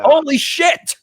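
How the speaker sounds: high-pitched real shock; astonished outburst. Real, not acted. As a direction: blurt it out high in disbelief. Reacts to billion-dollar jackpot.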